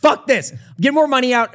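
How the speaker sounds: fed-up gambler snapping at his losses; upset outburst. Retold or imitated. Gavin after losing at roulette, upset and loud. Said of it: "Gavin was so upset".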